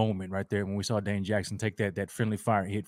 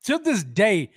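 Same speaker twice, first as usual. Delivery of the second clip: worked-up, higher-pitched grievance; ticked off; 8 semitones higher, 4 dB louder, slower than usual. Real, not acted. Fan recalling a hit that still rankles, ticked off. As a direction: vent a long-held grudge, starting loud. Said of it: "ticks me off".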